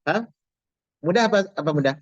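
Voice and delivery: ordinary talk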